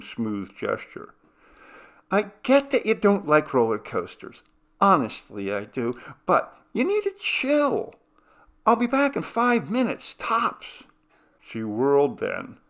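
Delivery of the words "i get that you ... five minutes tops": high, brushing-off big sister voice; Cindy, breezy older sister. Acted. Older sister brushing off scared sister, high and breezy. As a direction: shrug off her worry and tell her to chill.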